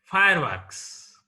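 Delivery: "Fireworks" with FIRE stressed, works falling away; stress on first noun. Shown as a demonstration. Teacher showing a compound noun, "Fireworks" stressed on FIRE. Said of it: "Stress is laid on the first".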